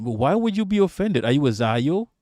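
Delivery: ordinary delivery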